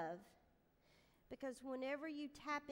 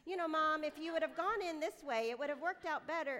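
put-on louder, higher know-it-all kid; insistent little parenting lecture, pitched up; lecturing child; 4 semitones higher, 6 dB louder than usual. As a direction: imitate a daughter lecturing her mom. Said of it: "telling me how to parent".

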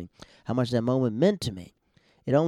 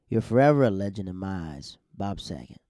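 ordinary talk

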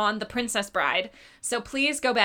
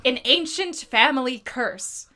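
slow, hammed-up blurb read; dramatic reading; 2 semitones higher, 4 dB louder than usual. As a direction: read it like an epic trailer. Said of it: "kind of hilarious".

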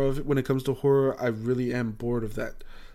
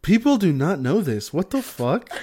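high, loud disbelief breaking into laughter; laughing, raised-pitch outrage; amused outrage; 6 semitones higher, 4 dB louder than usual. Real, not acted. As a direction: protest incredulously, laughing by the end. Outraged disbelief at the casual answer.

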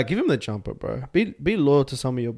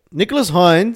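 higher and louder, rising hype; excited call; 6 semitones higher, 8 dB louder, slower than usual. Real, not acted. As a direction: call his name out like a fan. Name repeated, then hyped.

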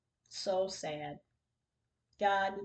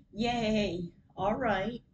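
a bright, louder burst of joy; excited; 2 semitones higher, 7 dB louder than usual. Retold or imitated. Replying gladly to the photographer who agreed, excited. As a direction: cheer it out like good news just came. Writing back thrilled to Beep.

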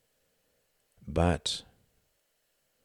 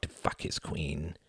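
much softer, slow menacing threat; menacing hush; 9 dB quieter than usual. A narrator's reading. The bald man taunting Tom, soft slow menace.

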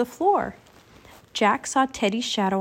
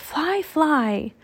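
high, airy call; 6 semitones higher than usual. A narrator's reading. Annie calling to Jack as she flies, calling out. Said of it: "she called".

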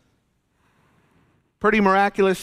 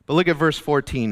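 ordinary delivery

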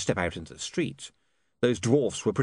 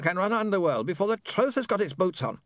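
higher-pitched smooth villain voice; pitched-up replayed speech; recorded villain; 10 semitones higher than usual. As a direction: play back the villain's speech through an imp.

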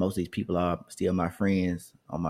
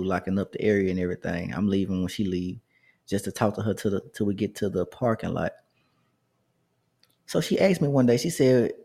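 ordinary talk, nothing marked

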